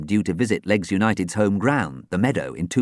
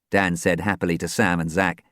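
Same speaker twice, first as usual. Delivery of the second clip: usual speaking voice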